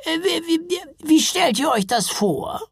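high, hesitant troll voice; stammering troll mother. A narrator's reading. Troll mother asking how it would work, stammering.

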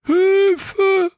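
tiny very high cry for help; thin little voice. A narrator's reading. A baked soul cries from inside the cookie, thin very high call. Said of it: "ein dünnes Stimmchen rufen".

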